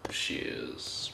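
soft, breathy little toast; whispered cheers. Real, not acted. Toasting a disliked drink.

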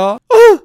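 high, loud cartoon scream; cry of fright. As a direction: yelp as if grabbed. Villager grabbed by the attacker.

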